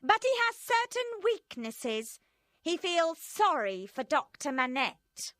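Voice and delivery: high, loud hard revolutionary woman; Madame Defarge voiced. A narrator's reading. Madame Defarge praising her husband to friends, high and hard. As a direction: play her cold and proud.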